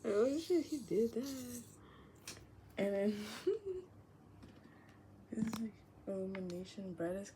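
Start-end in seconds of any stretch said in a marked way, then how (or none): 0.0-1.6 astonished exclamation. Retold or imitated. Imagined reaction to bold outfit.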